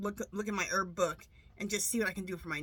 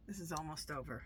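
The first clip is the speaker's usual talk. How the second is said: much softer and a little lower, relieved; quiet relief; 5 semitones lower, 12 dB quieter than usual. Retold or imitated. Kamala sighs that it is almost over, quietly relieved. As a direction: murmur it like the weight is lifting. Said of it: "She's like".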